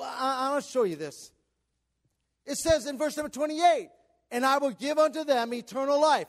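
loud, high-pitched preaching cadence; full-force preaching. Acted. Pastor preaching scripture to the congregation, loud and fervent. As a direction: preach it out to the whole room.

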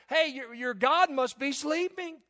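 high, loud sarcastic jeering; scornful mock pity for a sleeping god; mocking taunt. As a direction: taunt the false prophets. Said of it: "Elijah started mocking me and says".

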